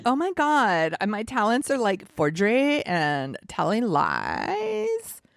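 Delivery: put-on ditzy California valley girl. Shown as a demonstration. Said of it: "I could do Valley Girl".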